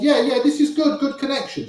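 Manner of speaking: high-pitched mock French receptionist; attempted French accent. Receptionist reassuring the guest about the modem, attempted French accent. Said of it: "I can't do a French accent".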